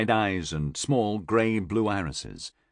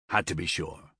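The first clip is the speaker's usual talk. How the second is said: soft, low, offhand reply; unsympathetic; 5 semitones lower, 6 dB quieter than usual. A narrator's reading. Lucan after cutting Florian's hand, unsympathetic. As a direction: shrug it off without sympathy. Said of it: "Lucan said without sympathy".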